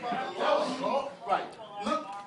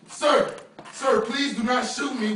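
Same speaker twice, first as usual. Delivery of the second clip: louder, desperate begging for life; terrified pleading; 5 dB louder than usual. Real, not acted. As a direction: beg him not to shoot, panicked. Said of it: "I'm fucking scared right now".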